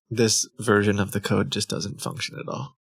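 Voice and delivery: ordinary delivery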